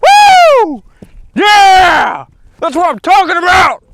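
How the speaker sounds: very high, loud victory whoop; triumphant shout. Real, not acted. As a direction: cheer the catch at the top of your voice. Landing the fish at last.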